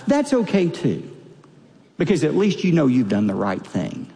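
ordinary delivery

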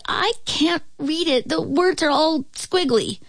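put-on high-pitched little boy's voice; young Ben. A narrator's reading. Ben explains he cannot read the book, high child voice. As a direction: plead it high, like a puzzled child. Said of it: "Ben said".

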